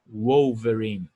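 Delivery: "Wolverine." very slow, W as a U glide; slow correct W. Shown as a demonstration. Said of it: "Eles falam". ("Wolverine." lento, com som de U)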